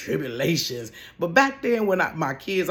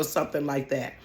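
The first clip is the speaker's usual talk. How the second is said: ordinary talk, nothing marked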